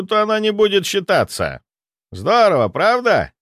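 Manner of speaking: приподнятая, звонкая официальная речь декана; объявляй результат выше обычного, с торжеством; торжествующий декан. Acted. Декан оглашает решение жюри. (торжествующий голос декана)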